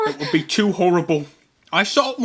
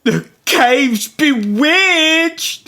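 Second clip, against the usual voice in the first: very slow, high, louder panicked cry; terrified cry; 7 semitones higher, 6 dB louder, much slower than usual. Acted. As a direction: wail it in superstitious terror. Pirates think cave bewitched.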